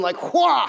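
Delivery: a big cartoon swing yell; sword swing shout.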